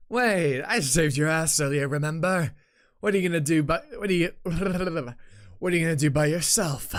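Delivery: lightly hammed-up indignation; indignant game character. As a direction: play an affronted ally calling someone back. Voicing game character's protest.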